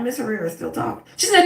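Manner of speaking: ordinary talk, nothing marked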